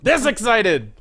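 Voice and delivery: sudden high, loud burst of excitement; excited outburst. Shown as a demonstration. Caster demonstrates his excitement to co-caster, excited outburst. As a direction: shout it to show just how excited you are. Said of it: "Tell me more about how excited you are".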